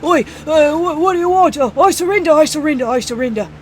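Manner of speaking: put-on high, loud panicked "Oi" accent; panicked yelping in a put-on accent; corrected accent. Store owner redoes surrender to goblins, panicked in put-on accent. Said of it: "wrong, wrong, uh, accent".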